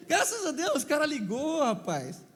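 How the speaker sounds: high, giggling thanks; laughing. Real, not acted. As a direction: say it while still laughing. Still laughing after the punchline.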